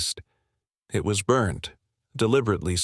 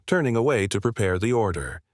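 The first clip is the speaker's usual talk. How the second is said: ordinary delivery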